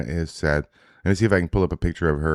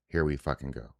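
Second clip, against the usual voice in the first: ordinary talk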